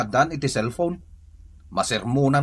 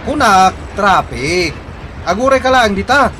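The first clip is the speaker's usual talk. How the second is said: much louder and higher, calling out down a bad line; shouting into the phone; 13 semitones higher, 8 dB louder than usual. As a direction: yell every word so the caller can hear over traffic. (napigsa nga agpukpukaw iti telepono)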